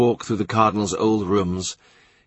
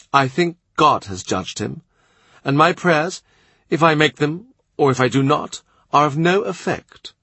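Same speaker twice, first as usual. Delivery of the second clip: lightly raised, poised court lady; a proud young woman reasoning aloud; a hint of a sharp-witted lady; Anne's voice; 6 semitones higher than usual. A narrator's reading. Narrator voices Anne again.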